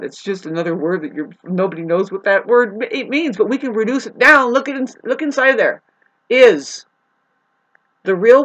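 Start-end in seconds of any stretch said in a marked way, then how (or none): none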